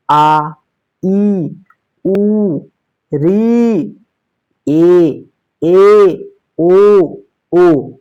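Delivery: आ, ई, उ, री, ए, ओ each drawn out, separately; long vowels. Shown as a demonstration. Sanskrit teacher demonstrating long vowels आ, ई, उ, री, ए, ओ, each held long. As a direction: hold आ, ई, उ, री, ए, ओ for two beats each. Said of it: "दीर्घ स्वर है".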